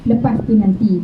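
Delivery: ordinary talk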